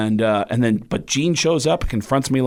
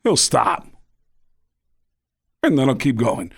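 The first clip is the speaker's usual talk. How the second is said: halting stop-and-go delivery with odd long pauses; Christopher Walken. Acted. Comedian demonstrating an actor's weird voice, stop-and-go impression. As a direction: stop dead mid-thought, then carry on. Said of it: "really weird stop and go kind of voice".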